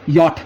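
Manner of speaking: यॉट said "yot"; German letter J. Shown as a demonstration. Teacher showing learners German J, यॉट as "yot". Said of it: "जे का आएगा".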